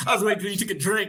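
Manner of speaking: fast and laughing. Real, not acted. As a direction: laugh it out quickly. Laughing at own prank.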